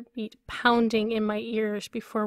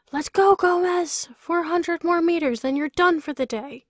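shouted high and loud from below; trainer yelling; 7 semitones higher, 4 dB louder than usual. A narrator's reading. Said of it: "my trainer yells".

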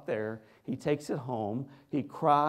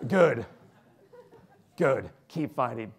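gruff approval; 2 semitones lower, 3 dB louder than usual. Retold or imitated. Announcer encouraging the boy in the bed, firm. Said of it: "and then he says".

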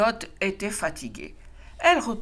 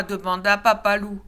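usual speaking voice

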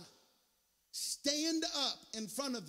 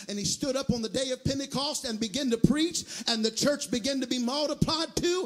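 loud sermon cadence climbing higher; full-force preaching; 6 semitones higher, 14 dB louder than usual. Real, not acted. Preacher urging the congregation not to dwell on weakness, full-force preaching. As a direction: build steadily into a shouted, driving rhythm.

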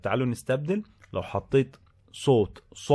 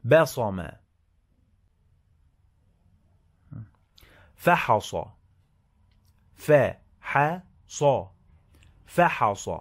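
slow, clear dictation of each word; dictating; 2 semitones higher than usual. Shown as a demonstration. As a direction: read each word slowly for a dictation test. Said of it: "It's a dictation activity".

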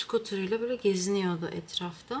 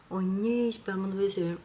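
usual speaking voice

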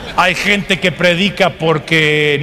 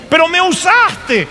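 high, drawn-out acted complaint; indignant protest; 10 semitones higher, slower than usual. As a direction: protest to God in a raised, stretched voice. El rechazado reclama a Dios. (queja indignada, aguda y alargada)

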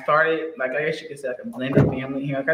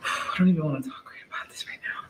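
sighing whisper, a bit fast; emotional whisper; 5 dB quieter, faster than usual. Real, not acted. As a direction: whisper it, too upset to talk. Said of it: "It's emotional".

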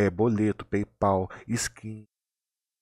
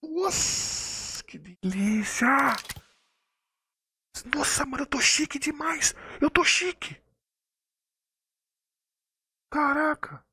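much higher, drawn-out squeal of delight; ecstatic squeal; slower than usual. Real, not acted. Streamer squeals over his big drop. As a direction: squeal like you just won big.

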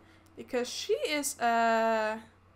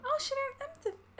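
surprised; 13 semitones higher than usual. Real, not acted. Delighted surprise at result.

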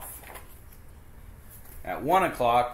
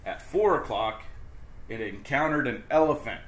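plain reading voice

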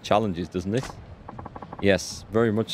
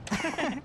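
high squeaky laugh; 7 dB quieter than usual. Real, not acted. After reading lines.

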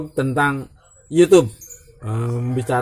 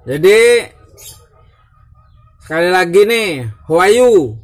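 higher and louder hello call; loud greeting; 4 semitones higher, 6 dB louder than usual. Acted. Vlogger loudly greeting his viewers again. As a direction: call out to the audience.

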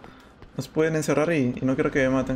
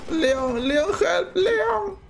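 high, wailing call to a partner; plaintive high-pitched plea; calling for help; 10 semitones higher, much slower than usual. Real, not acted. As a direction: call out higher with each cry.

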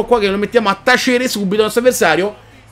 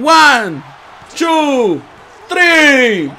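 higher louder pin count; count shout; 4 semitones higher, 4 dB louder, much slower than usual. Real, not acted. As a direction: call it like a referee.

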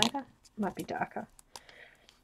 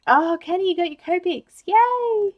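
excited; 10 semitones higher, 11 dB louder than usual. Real, not acted. Child brings her markers.

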